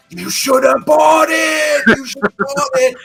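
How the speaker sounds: high, slow mock yell at himself; self-scolding yell. Driving away from the poster, scolding himself with a high yell. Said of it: "mate, I was like".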